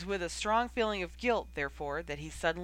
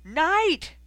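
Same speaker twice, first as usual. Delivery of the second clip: much higher and louder angry shout of the name; furious call; 10 semitones higher, 9 dB louder than usual. A narrator's reading. Coach Oliver catching Knight slacking, furious shout. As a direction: bark her name from the ground.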